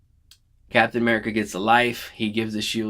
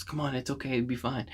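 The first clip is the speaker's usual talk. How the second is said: softer, gentle comforting voice; soft reassurance; 3 semitones higher, 8 dB quieter than usual. Retold or imitated. Pepper soothing dying Tony, soft comforting voice. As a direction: ease him gently, quietly.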